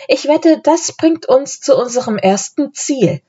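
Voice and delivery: high, eager exclamation; exclaiming. A narrator's reading. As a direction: bet on it with a child's excitement. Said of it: "rief Anne".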